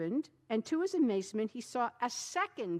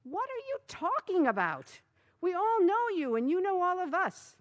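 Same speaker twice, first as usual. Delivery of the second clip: neighbors protesting; 10 semitones higher, 7 dB louder than usual. A narrator's reading.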